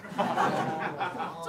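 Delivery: short real laugh; laughing at the punchline. Real, not acted. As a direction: burst out laughing. Laughs at own joke.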